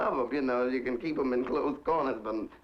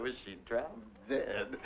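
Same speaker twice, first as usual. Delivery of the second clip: quiet aside; 8 dB quieter than usual. Retold or imitated. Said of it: "I said".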